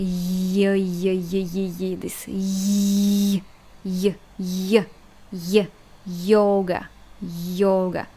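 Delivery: y said like the y in yoga; a short y glide before the vowel; Russian y sound demo. Shown as a demonstration. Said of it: "the first sound of the word".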